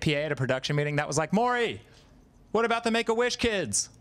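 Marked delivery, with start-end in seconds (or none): 1.3-3.9 pitched-up quoted plea; high mock question. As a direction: ask it high and pleading. Quoting someone asking Maury.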